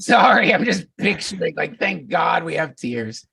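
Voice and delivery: giggling through the picture; laughing. Real, not acted. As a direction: laugh it out, slowing down. Laughing at vomit image.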